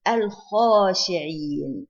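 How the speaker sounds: al-khashi'in very drawn out and loud, L sounded; lam pronounced. Shown as a demonstration. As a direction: stretch al-khashi'in, keeping the lam before kh. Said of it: "it is lam qamariya".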